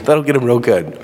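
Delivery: playfully conniving, a touch of a villain; sly Mary. Pretend. Mary agrees with Martha's line, slyly scheming. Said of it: "And Mary goes".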